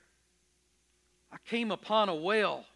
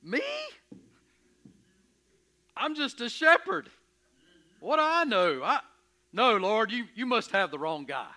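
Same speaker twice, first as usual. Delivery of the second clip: startled protest; 6 semitones higher, 6 dB louder than usual. Acted. Moses protests God's call.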